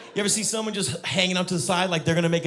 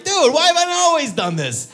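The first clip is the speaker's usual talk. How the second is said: loud, high exclamation of delight; excited discovery; 14 semitones higher, 8 dB louder than usual. Acted. Someone inside a duvet cover, thrilled. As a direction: burst out high and loud, amazed.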